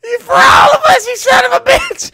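high, loud mock outrage; imitated angry heckler holler; heckling crowd. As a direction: shout him down from the audience. Acts out crowd heckling Curtis.

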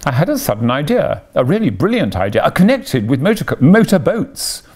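quick, rising rush of enthusiasm; carried away excitedly. A narrator's reading. Toad telling Ratty his brilliant idea, excited.

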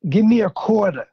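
showing off his New York accent on "quarter". Shown as a demonstration. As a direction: lean into the New York vowel in "quarter", like a born New Yorker. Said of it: "There's certain words that you can tell somebody's from New York".

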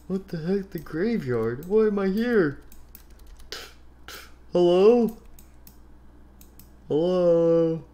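high wavering questions and calls; bewildered lost boy. Acted. Spring Boy lost in graveyard, high uneasy calling. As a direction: call out uncertainly into emptiness.

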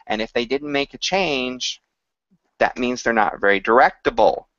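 normal talking voice

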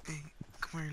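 ordinary talk

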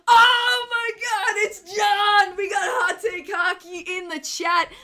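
loud, high, drawn-out shout of excitement; big high-pitched hype greeting; excited greeting. Real, not acted. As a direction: shout a thrilled welcome as a friend turns up. Excited greeting of a viewer arriving.

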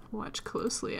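mock-mysterious whisper; eerie hushed whisper. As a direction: whisper the hint like a spooky secret. Echoes the rabbit's hint.

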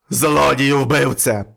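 slow, loud accusation; devil shouting. Acted. Devil catching the man, shouting slowly and loud. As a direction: drag out each word in fury. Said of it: "вигукує чорт".

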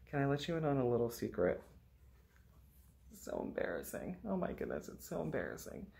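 soft fast secret-telling; hushed mock embarrassment; confiding hush. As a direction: lean in and confess it softly.

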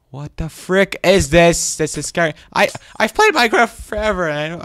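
high, loud, freaked-out disbelief; alarmed outburst. Real, not acted. Player seeing something never seen in Minecraft, alarmed outburst. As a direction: blurt it out, spooked and loud. Startled by scary place.

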